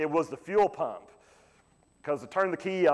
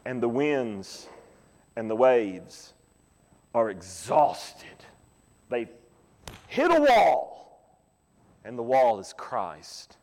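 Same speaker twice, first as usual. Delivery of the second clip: slow dramatic preaching; 6 semitones lower, much slower than usual. Acted. Preacher opening a sermon to his congregation, slow and dramatic. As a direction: preach it slowly, letting each phrase land.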